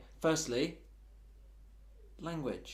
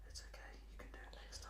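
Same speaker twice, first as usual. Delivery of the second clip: whisper; 9 semitones higher, 12 dB louder, much faster than usual. Acted. Consoling his own hand privately.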